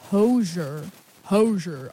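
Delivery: "Hozier" as HOE-zher, two syllables; mispronounced name. Real, not acted. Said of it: "I was wrong".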